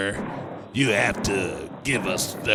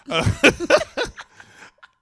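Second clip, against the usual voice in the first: laughing; 8 dB louder than usual. Real, not acted.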